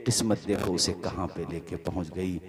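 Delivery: low, soft, grieving narration; hushed sad storytelling. Acted. Preacher tells the ruined son's fall, hushed and grieving. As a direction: draw them into the son's downfall quietly.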